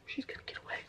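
hushed unvoiced aside; whisper. Real, not acted. Vlogger whispers an aside to viewers while showing headbands, whispering. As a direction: drop to a breathy secret whisper.

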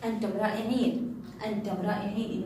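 ordinary delivery

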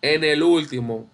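ordinary talk, nothing marked

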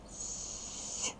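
"С" as a long soft hiss, no vowel; isolated soft "С", voiceless and drawn out; soft consonant sound. Shown as a demonstration. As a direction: hold a palatalized "С" on its own. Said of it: "Чому с м'який?".

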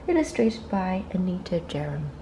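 plain reading voice

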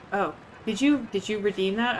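ordinary talk, nothing marked

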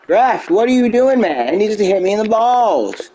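hammed-up aggrieved protest; indignant appeal. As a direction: wail your grievance to the ref. Appealing to the ref in play.

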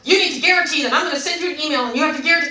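loud, high, rapid-fire insisting; pushy rant, imitated. Rep talking over customer about guarantee, pushy. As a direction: run on without letting anyone answer.

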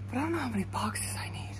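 hushed fast whisper. Real, not acted. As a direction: whisper it quickly under your breath. Late night, store by house.

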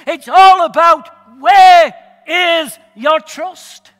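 loud, building preaching. Acted. Preaching climax.

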